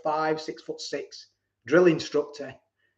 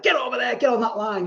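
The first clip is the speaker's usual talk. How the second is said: higher, sharp barked order; mock drill shout; 7 semitones higher than usual. Imagined guard barking at the new recruit, mock shout. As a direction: imitate a drill shout lightly. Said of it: "to shout at me".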